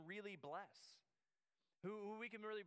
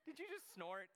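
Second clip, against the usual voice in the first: higher-pitched question through a laugh; real amused disbelief; amused teasing; 5 semitones higher than usual. Real, not acted. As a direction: catch someone out, laughing. Teases listener who snorted while laughing.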